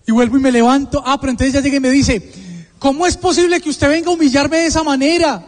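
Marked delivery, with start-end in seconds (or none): 2.8-5.4 reclamo agudo de madre, imitado; reproche dolido. Retold or imitated. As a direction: pregunta como quien no puede creerlo, en tono alto. Madre reprocha, indignada, al hijo. (reclamo indignado de madre)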